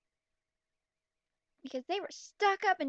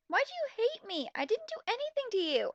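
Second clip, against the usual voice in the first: put-on high, sweet, wounded girl; high-pitched pretty voice; 8 semitones higher than usual. Heroine pleads with the mean girl in a high, pretty voice. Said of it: "in a really high-pitched, pretty voice".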